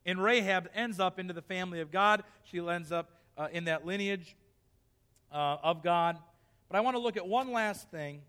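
normal talking voice

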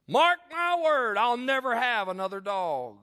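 high, drawn-out shouted proclamation; hammed-up loud declaration, high then easing down; loud retold vow. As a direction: holler it slowly like a solemn oath. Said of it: "Wish I wouldn't have marked it so loud".

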